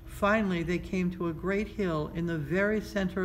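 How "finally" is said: plain reading voice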